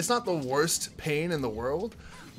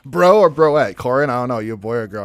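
loud; 13 dB louder than usual. Real, not acted. Shouting question at chat.

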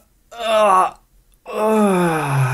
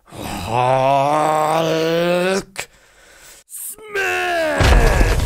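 Hulk roar. Pretend. Hulk roaring at the monsters outside his house, shouted. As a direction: let the smash explode out of you.

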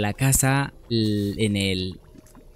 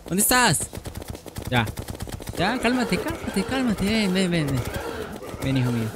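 high, coaxing come-here; soothing coax; 6 semitones higher, 4 dB quieter than usual. Real, not acted. Player coaxing a frightened creature to calm down. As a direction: coax it over like a spooked animal.

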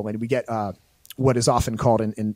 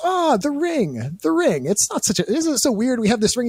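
mock coy Boromir; 11 semitones higher than usual. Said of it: "And goes".

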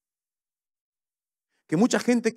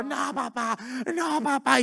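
put-on higher, small child's pleading; a scared little boy begging his dad; frightened child; 8 semitones higher, slower than usual. Sick son begging his father on the hospital bed, lightly voiced child.

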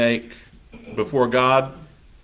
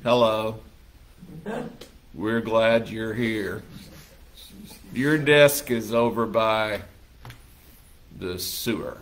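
low, soft, slow and flat; bored office worker greeting the new hire; deadpan coworker; 4 semitones lower, 6 dB quieter than usual. Pretend. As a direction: drain all the joy out of the welcome. Gloomy coworkers greet newcomer.

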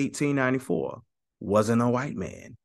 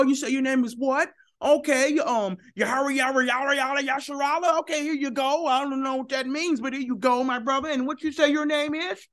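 friendly handout voice; 12 semitones higher than usual. Retold or imitated. Voicing Strong passing out concordances.

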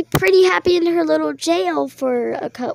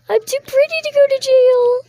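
much higher, louder whine; high whiny protest; 9 semitones higher, 5 dB louder than usual. Pretend. Accused girl protests the arrest in a high whine.

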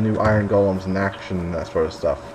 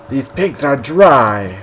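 slow mock complaint; 6 dB louder, much slower than usual. Comic complaint, drawn out.

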